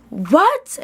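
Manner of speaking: high, loud rising "What?"; shocked what. Real, not acted. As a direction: gasp out a stunned "What?". Shock at killing plan.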